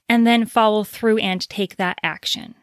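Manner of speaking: ordinary talk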